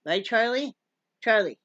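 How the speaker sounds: usual speaking voice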